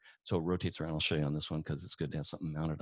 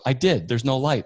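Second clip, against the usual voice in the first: louder, higher mock protest; retold exasperated caller; 6 semitones higher, 11 dB louder than usual. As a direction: imitate a caller insisting, raised voice. Retold frustrated caller arguing back.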